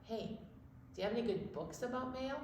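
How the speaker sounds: ordinary delivery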